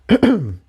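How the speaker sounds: a quick polite throat clear. A narrator's reading. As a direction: clear the throat to break the silence. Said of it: "she cleared her throat".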